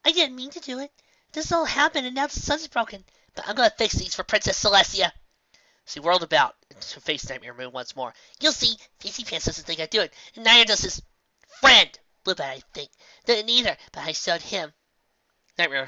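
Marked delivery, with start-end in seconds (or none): 0.0-5.1 put-on high childlike pleading; a little filly defending herself; young filly voice. A narrator's reading. Filly tells Nightmare Moon she will fix the sun, high childlike voice. 8.4-14.7 put-on high childlike pleading; a little filly defending herself; young filly voice. A narrator's reading. Filly tells Nightmare Moon she will fix the sun, high childlike voice.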